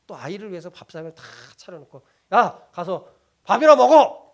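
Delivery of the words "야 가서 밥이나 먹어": loud, slow grumpy order; a mom still angry, calling the child to eat; mock gruff mother's yell; gruff shout. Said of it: "심하게 야단치잖아요".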